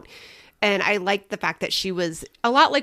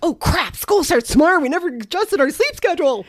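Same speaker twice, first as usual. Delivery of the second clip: higher-pitched sudden realization; mock panic; 7 semitones higher, 5 dB louder than usual. Forgetful mom realizes school starts tomorrow, higher-pitched mock panic. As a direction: blurt it out in acted alarm.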